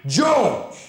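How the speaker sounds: high, loud proud announcement of the name; "Saint" lifted high and loud, ringing out; grand announcement. Real, not acted. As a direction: present him like a champion.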